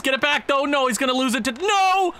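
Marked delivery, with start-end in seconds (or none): 1.5-2.1 dismayed shout. Real, not acted. Puck lost at the doorstep.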